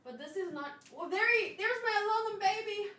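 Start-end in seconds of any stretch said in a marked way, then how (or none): none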